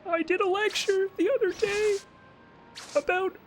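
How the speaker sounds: high whiny Kermit-like voice; Jordan Peterson impression. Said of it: "I still gotta do a Jordan Peterson impression".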